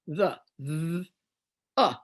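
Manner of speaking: THE split into "th" and "uh"; sounding out. Shown as a demonstration. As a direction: say "The", then hold the buzzing th and add uh. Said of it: "We say, uh".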